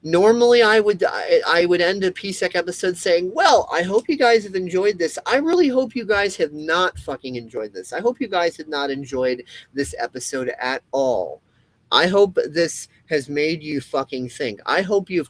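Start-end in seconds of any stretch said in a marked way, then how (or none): 0.0-9.9 heated rant. Real, not acted. Heated rant about sign-off.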